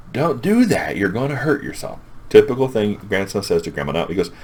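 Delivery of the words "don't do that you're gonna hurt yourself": higher-pitched mock scolding; worried scolding. Grandson warns grandma she'll hurt herself, mock scolding. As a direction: warn with a raised voice, lightly hammed-up.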